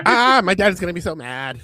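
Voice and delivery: put-on high whiny kid voice, fast. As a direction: whine like a scared little boy. Whining about dad, put-on voice.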